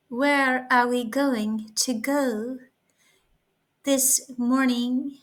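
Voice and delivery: slow, drawn-out posh English, like royalty; a mild attempt at an upper-class English accent. Shown as a demonstration. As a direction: play a lightly done English royal greeting guests. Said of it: "as if I was, some kind of a, English royalty".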